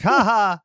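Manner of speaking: high, loud fake "ha ha"; put-on cackle mimicking a movie character; imitated random laugh. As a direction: burst out with a mock, out-of-place laugh. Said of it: "just randomly laughing".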